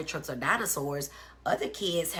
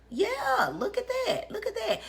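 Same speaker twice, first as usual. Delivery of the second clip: delighted. Real, not acted. Pleased by viewer comment.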